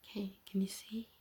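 usual speaking voice

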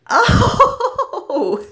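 high, loud "oh" breaking into laughter; bursting into laughter. Acted. Woman cracking up at her own joke to an angry man, laughing. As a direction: crack up mid-exclamation.

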